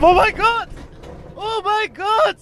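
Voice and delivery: high excited shrieks of a steep launch; thrilled screaming. Real, not acted. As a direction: squeal like on a roller coaster.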